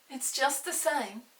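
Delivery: put-on delighted boy, a touch slow; happy child back in his chair. Peter voiced in story.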